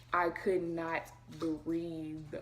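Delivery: ordinary delivery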